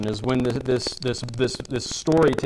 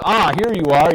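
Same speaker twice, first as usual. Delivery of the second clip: a lightly voiced bishop, greeting with raised pitch and volume; glad exclamation; 3 semitones higher, 7 dB louder than usual. Bishop greeting the returned thief, mildly exclaiming. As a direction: exclaim it gladly, a touch higher and louder. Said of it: "he exclaimed".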